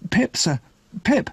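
put-on high child's voice, anxious to obey; timid, high-pitched reply; frightened boy. A narrator's reading. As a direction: answer like a scared small boy. Frightened boy answers convict in story.

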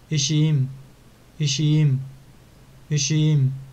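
ایشیم drawn out on a long i, falling at the end; ایشیم repeated slowly; careful pronunciation. Shown as a demonstration. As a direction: model ایشیم for the class. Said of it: "در تلفظش خیلی دقت کنید".

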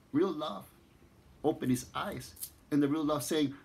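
plain reading voice

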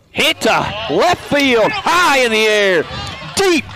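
high, loud, drawn-out play-by-play; thrilled sportscaster's call, slow and ringing; excited home run call. Real, not acted. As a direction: belt out the home run, stretching the big words.

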